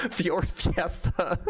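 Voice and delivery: slow, laughing through Fjord Fiesta; can barely get the words out for laughing. Real, not acted. Streamer reading a silly car name to chat, laughing. Reading a joke donation, cracking up.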